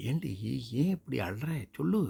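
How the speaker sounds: worried mother coaxing. Acted. Mother coaxing crying daughter.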